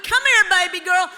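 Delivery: cooing, comforting Southern secretary; tender call. Retold or imitated. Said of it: "she'd call you and".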